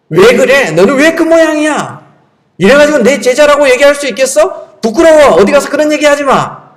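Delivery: high-pitched, hammed-up telling-off; mock scolding. Jesus berating a failed disciple, pretend angry scolding.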